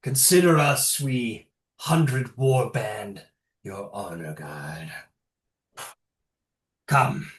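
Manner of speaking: lightly voiced shaman. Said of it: "horse and says".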